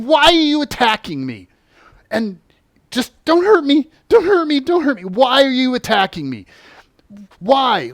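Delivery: high, loud, frantic demand and plea; pleading shout at the attacker; desperate shouting. Retold or imitated. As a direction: yell it like you're being attacked. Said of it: "I'm like".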